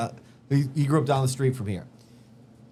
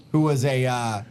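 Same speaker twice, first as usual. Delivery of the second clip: ordinary talk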